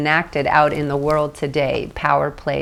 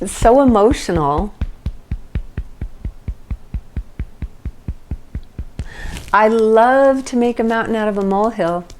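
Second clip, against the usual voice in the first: higher, louder hammed-up drama queen; mock dramatic; 7 semitones higher, 5 dB louder than usual. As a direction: ham up the emotion playfully. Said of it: "It's actually kind of funny".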